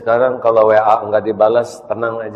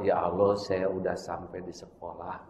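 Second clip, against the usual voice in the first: quiet prayer; 4 semitones lower, 12 dB quieter than usual. Acted. Child's quiet prayer on arrival.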